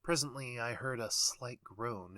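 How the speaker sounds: ordinary delivery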